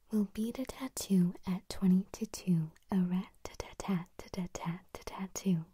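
loud stage whisper, every consonant crisp; crisp whispered tongue twister. Shown as a demonstration. ASMR host whispering a tongue twister to viewers, crisp and loud. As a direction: whisper it hard, spitting out each t.

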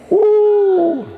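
high, loud whoop; a high joyful woo; excited whoop. Real, not acted. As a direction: whoop at the crowd. Crowd reaction to chargers.

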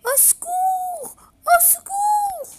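very high, loud slow scream; girl screams as the wolf pounces; screaming for help. Acted. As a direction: shriek it in terror.

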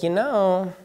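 high, quiet, halting mimicry of boss; awkward hedging. Boss hiring him remarks on his looks, awkwardly mimicked. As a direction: search carefully for the word, pitched high.

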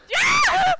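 huge high triumphant whoop; a laugh bursting into a loud cheer; laughing shout. Real, not acted. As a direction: let out a real victory yell.

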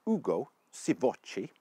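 name said in a careful Italian accent; attempted Italian pronunciation. Shown as a demonstration. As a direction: roll out the name in your best Italian. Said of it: "I'll say that in my best Italian accent".

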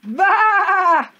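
enormous high, long bleat; full-volume sheep-noise yell; shouted baa. A narrator's reading. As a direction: blast it out to startle a bird. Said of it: "he shouted".

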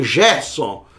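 "Gerson" starting with a zh sound, "Zhérson"; Portuguese soft G. Shown as a demonstration. Teacher showing the mistaken Portuguese reading, g as zh. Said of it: "como no português".

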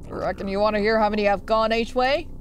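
put-on low, folksy old-timer voice; old man voice. Old fish offering the player his count, old man voice. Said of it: "That's an old man voice".

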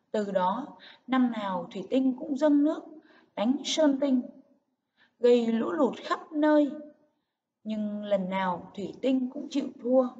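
emphatic "dâng nước" and "khắp nơi", pauses between phrases; stressed key words. Shown as a demonstration. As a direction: lift "dâng nước", "lũ lụt" and "khắp nơi", then drop for "chịu thua". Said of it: "các từ cần nhấn giọng".

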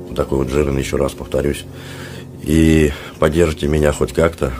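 ordinary talk, nothing marked

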